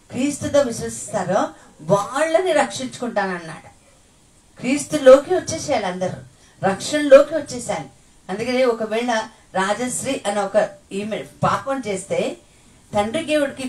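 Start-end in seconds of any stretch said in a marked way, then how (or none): 4.6-7.9 higher, louder call to come; urgent preaching. Real, not acted. Preacher calling all into salvation, urgent. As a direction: plead with the crowd.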